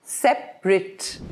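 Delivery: slow separate with two syllables, ending in rit. Shown as a demonstration. Teacher shows the adjective separate as sep-rit. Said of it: "here you've said separate".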